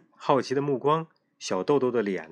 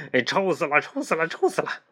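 put-on higher girl voice repeating in disgust; 6 semitones higher than usual. A narrator's reading. Girl complains her hanky stinks, repeating in mock disgust. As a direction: hold the hanky away, grossed out. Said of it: "一个劲儿地说".

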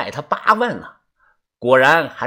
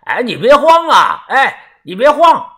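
high, raised voice through a door; loud, insistent calling; 8 semitones higher, 4 dB louder than usual. A narrator's reading. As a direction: call out urgently to keep the deal alive. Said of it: "拍门大声地说". (拍着门高声急喊，不依不饶)

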